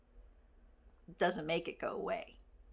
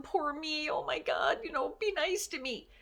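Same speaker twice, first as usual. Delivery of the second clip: higher, whiny poor-me voice; mock victim whine; 7 semitones higher than usual. Shown as a demonstration. Coaching listeners, she mocks a self-pitying victim, higher.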